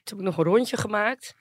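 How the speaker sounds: ordinary talk, nothing marked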